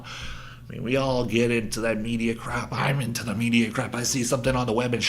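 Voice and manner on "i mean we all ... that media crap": ordinary delivery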